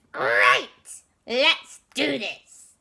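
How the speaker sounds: loud, eager Gru cheer. Acted. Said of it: "He said".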